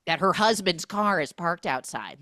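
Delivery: high, loud, hammed-up ranting; mock fury. Recapper playing the jealous neighbour up all night, mock furious. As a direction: rant in mock rage, drawing out the key words. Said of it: "And I'm furious".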